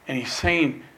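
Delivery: usual speaking voice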